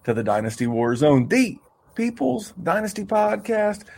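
high, slow stretched-out welcome; podcast host intro. Acted. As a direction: drag out the welcome like a showman. Host's drawn-out show intro.

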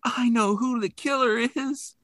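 high, slow, hammed-up plea; lightly voiced panicked teenager; mock frightened cry. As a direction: wail it like a scared girl. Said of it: "she's like".

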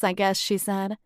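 plain reading voice

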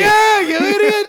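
high, loud insult; angry yelling. Acted. Attacked Jerry answers his cousin, angry yelling. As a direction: snap back in a high shout.

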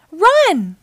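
high, loud one-word warning; urgent shout. A narrator's reading. Naughty Ninja fleeing the bubbles, urgent shout. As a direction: yell it as an alarm. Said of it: "shouted Naughty Ninja".

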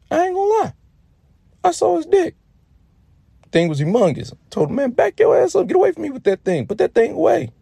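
high, loud squawk of mock alarm. Man retelling telling his brother to back off, high mock alarm. Acted brother-at-showers tale, recoiling.

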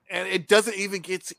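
ordinary talk

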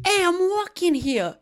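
high, slow New York tough-guy yell; New York movie line. Retold or imitated. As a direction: shout at cars like a New York cabbie. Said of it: "I'm walking here" is, like, a very, very famously a New York City movie".

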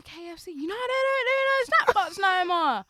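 mock gossip voice. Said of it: "And she's like".

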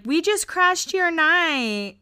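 high, slow sweet apology; sweet Lauren. Retold or imitated. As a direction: coo it sheepishly. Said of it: "Lauren's like".

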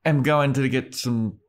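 lightly voiced nervous Buffy; mock hesitant Buffy. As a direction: stumble through the lie like a bad liar. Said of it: "Buffy's like".

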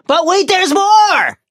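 slow, high, booming pitchman; infomercial announcer. Pretend.

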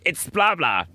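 high, loud mock outburst; imitating exasperated listeners. Host voicing listeners who know the answer, mock exasperated. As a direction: call out the answer in mock frustration. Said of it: "listeners will be going".

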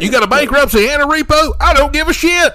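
high, loud brash pitch; hammed-up pitchman. Parodying a dealer's ad, loud brash pitch. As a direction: bark it like a no-limits dealer.

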